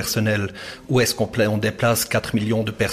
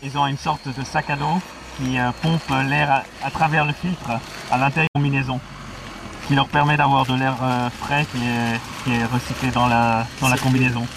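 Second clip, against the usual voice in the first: muffled voice behind a mask; through a gas mask; 3 semitones higher than usual. Real, not acted. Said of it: "On vous entend respirer".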